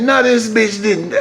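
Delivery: drawn-out laughing no; slow it down, laughing; slow laughing disbelief. Retold or imitated. Finding the belt off again, slow and laughing.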